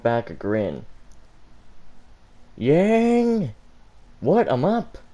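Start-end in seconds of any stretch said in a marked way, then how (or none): 2.6-3.5 high, loud wake-up call; shout. Acted. Waking Yang by shouting. 4.2-5.0 jolted awake, higher and louder; startled. Acted. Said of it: "Yang stammered".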